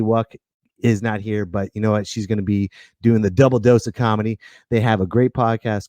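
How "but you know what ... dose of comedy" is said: normal talking voice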